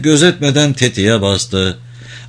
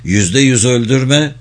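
plain reading voice

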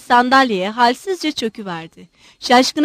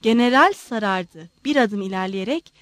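plain reading voice

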